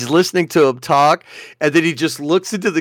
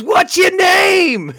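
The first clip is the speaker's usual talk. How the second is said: very high, drawn-out holler; shouted question; 3 dB louder, much slower than usual. Retold or imitated. Man calls to stranger across a lake, hollering. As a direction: stretch it out to carry across water. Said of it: "He goes".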